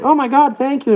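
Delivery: put-on high, delighted old-lady voice; lightly voiced thankful granny; grateful old lady. Old lady thanking him at the door, high and delighted. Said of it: "she's like".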